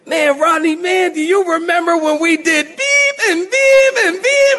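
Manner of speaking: high, excited mock reminiscing; old friend reminiscing. Old friend telling Rodney's stories before his family, excitedly. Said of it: "they start telling, you know, all the old stories".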